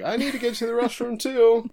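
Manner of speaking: high-pitched Kogoro-style excuse, played for laughs; mock urgent plea. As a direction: blurt it out in a silly high voice. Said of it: "He's like".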